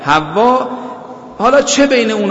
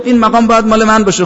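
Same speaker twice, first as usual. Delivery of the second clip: higher, louder quoted protest; mock Iblis staking his claim, voice raised; retold indignant claim; 4 semitones higher, 5 dB louder, faster than usual. Iblis claims the caliph's station before God, retold indignant claim.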